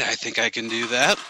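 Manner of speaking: usual speaking voice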